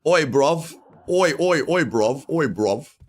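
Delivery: hamming up a cockney call; London accent. Pretend. Calling a chat viewer in a loud cockney accent. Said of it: "Your accent reading needs a bit of work".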